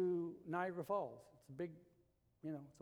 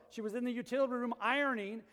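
usual speaking voice

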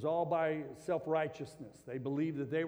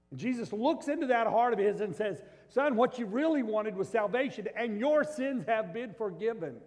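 loud, high preaching voice, driving each point home; fiery preaching; 8 semitones higher, 7 dB louder than usual. Acted. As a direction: preach it out to the whole room with full force. Preaching at full force.

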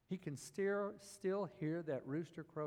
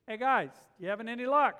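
loud, higher call across the water; 8 semitones higher, 13 dB louder than usual. Retold or imitated. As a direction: call out to the boat a hundred yards off. Jesus calls out to boat.

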